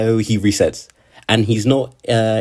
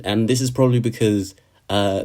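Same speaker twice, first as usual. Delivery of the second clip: usual speaking voice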